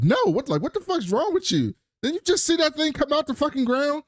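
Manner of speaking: high-pitched retold disbelief; incredulous outburst. Objecting to going back for the equipment, incredulous high voice. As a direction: squeal it in mock disbelief.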